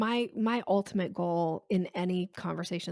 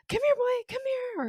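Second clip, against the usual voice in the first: very high and louder, sing-song come-here call; dog-calling voice; 4 dB louder than usual. Shown as a demonstration. Owner calling a dog over, high eager coaxing. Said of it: "when you're calling a dog".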